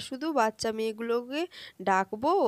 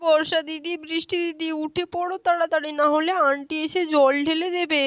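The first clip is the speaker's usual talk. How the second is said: put-on higher child voice warning older sisters; urgent child; 6 semitones higher, 5 dB louder than usual. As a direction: hurry them up with mild alarm. (দিদিদের জাগাতে বাচ্চার তাড়া)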